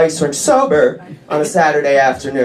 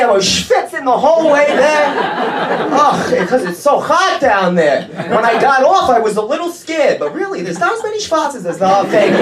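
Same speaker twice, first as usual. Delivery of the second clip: lightly voiced kvetchy New Yorker, higher; 6 semitones higher than usual. Comedian faking Yiddish talk for his Orthodox friend, mock kvetching. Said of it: "throw in Yiddish when I'm talking to him".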